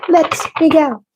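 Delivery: high, laughing "Let me go"; giggled "Let me go". Real, not acted. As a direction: squeal "Let me go" through a laugh.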